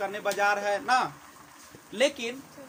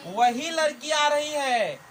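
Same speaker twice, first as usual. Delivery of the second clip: slow, louder and higher, pressing "वही लड़की आ रही है"; emphatic gloss; 5 semitones higher, 6 dB louder, slower than usual. Shown as a demonstration. Teacher dramatizing the meaning for students, slow and loud. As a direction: hammer home that it is that very girl. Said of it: "लंबा sound लेके".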